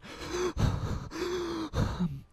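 high, gasping panic breaths; imitated hyperventilating. Mom on the phone after the dog is hit, hyperventilating. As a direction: mimic someone hyperventilating down the phone. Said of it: "She's hyperventilating".

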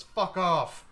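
sudden loud yell, pitch jumping up; yelled brush-off. Acted. Unseen local yells at the callers to get lost, loud. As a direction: bark it down from a window. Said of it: "just yells".

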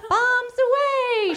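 high, slow drawn-out cry; very high, slow gleeful call; cries. Acted. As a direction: stretch it up high and long like a girl calling out. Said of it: "she cries".

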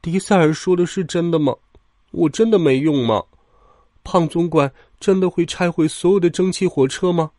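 downhearted little engine; sad. A narrator's reading. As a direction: wonder sadly whether you are useless. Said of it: "托马斯难过地说".

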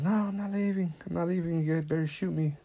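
high-pitched, retold protest; mock defiant. Princess standing among the guns, mock-defiant. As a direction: act out her refusal, pitched well up.

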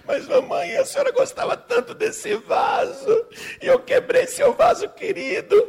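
hammed-up child crying, very high; squeaky tearful lament; wailing little girl. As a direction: wail guiltily like a small child. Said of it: "mas não chore mais".

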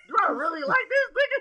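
high, fast put-on girl's voice; mock inner thought. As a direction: voice her doubt, hammed up high.

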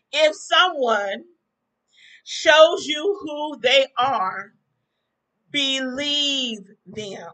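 drawn-out preacher cadence, each word held; sermon-style slow delivery to drive it home; preaching it slowly. Acted. To her viewers about Trump, slow preacher delivery. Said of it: "I'm singing my song".

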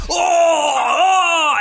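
high, loud mock howls of pain; hammed-up pained yelps. The Battletoad hit in the groin, yelping in mock pain. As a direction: yelp like a hurt cartoon frog. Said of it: "And he goes up".

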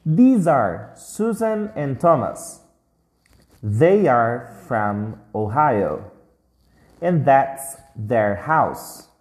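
slow, careful model sentences; teacher modelling for students, slow and clear; slow read-along. Shown as a demonstration. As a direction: read it out slowly for the class to copy. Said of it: "Então lê comigo essas frases".

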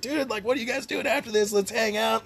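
mock-eager bar buddy; eager invitation. Voicing bar patrons asking to hang out, eagerly.

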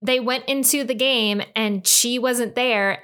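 normal talking voice

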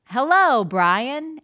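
loud, high hello to a student; teacher welcoming a student by name; cheerful teacher greeting. Shown as a demonstration. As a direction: greet the child with big energy. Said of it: "So when I say".

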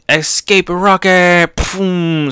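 high, slow, stretched move cry; attack-name call. Acted. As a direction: announce the move, then a blast noise. Calls out escape move name.

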